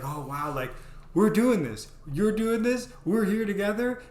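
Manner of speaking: put-on imagined girlfriend gushing excitedly. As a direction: burst out with joy together.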